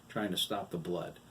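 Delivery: plain reading voice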